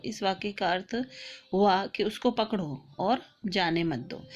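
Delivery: ordinary talk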